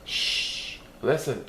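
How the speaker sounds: plain reading voice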